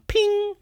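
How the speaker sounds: very high-pitched electronic ping; imitated ping. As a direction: make a high little click-on ding. Imitates the screen clicking on.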